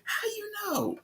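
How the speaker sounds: very high, tight mock voice; squeaky surprised villain. Villain surprised at Carter's answer, squeaky mock voice.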